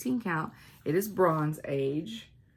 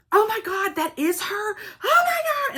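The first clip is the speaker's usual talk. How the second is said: much higher, loud squealing fangirl; excited fan scream; 6 dB louder than usual. Retold or imitated. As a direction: shriek like a starstruck fan. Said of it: "starts screaming and hugging on me".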